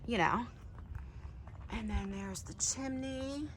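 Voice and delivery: hushed. Real, not acted. Sneaking into a site past workers.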